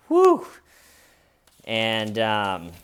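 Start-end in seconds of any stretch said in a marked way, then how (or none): 0.1-0.5 whistling whew. Retold or imitated. Comedians reacting to bad joke.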